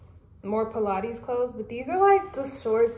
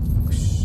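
whooshing sound; 6 dB louder than usual. Pretend. Sound effect of time passing.

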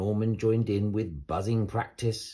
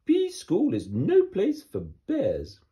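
louder, higher, grand royal scolding; stern Queen; 10 semitones higher, 6 dB louder than usual. Acted. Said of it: "said the Queen".

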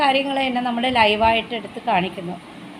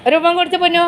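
higher, louder sing-song coaxing; 6 semitones higher, 6 dB louder, much faster than usual. Real, not acted. Mother coaxing her pet for a kiss, sing-song. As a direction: coax the little one sweetly for a kiss.

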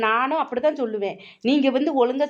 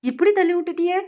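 higher, whiny fake complaint; mock hurt; 6 semitones higher, 3 dB louder than usual. Daughter-in-law pretends hurt at granny's push, higher and whiny. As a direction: play wounded after being shoved away.

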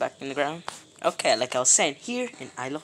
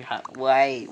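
ordinary talk, nothing marked

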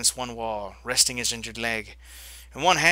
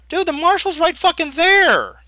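panicked shout; 10 dB louder than usual. Real, not acted. Player panics at marshal.

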